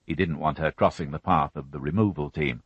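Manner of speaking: plain reading voice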